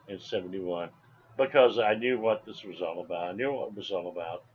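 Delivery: normal talking voice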